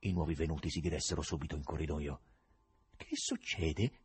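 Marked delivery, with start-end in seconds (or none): 3.0-4.0 soft, slow, hushed asking; quiet question. A narrator's reading. Husband quietly asks the two visitors following them, hushed. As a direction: ask it under your breath, slowly. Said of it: "chiese piano Nicanor Ivanovic".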